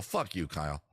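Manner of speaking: ordinary talk, nothing marked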